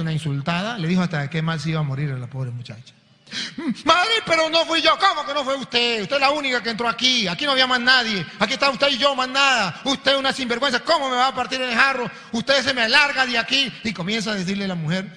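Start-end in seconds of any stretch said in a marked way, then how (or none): 3.9-5.0 pleading protest. Acted. Nun pleading with mother superior. 5.0-13.7 raised, scolding voice of an angry mother superior; a stern nun chewing out a novice. Acted.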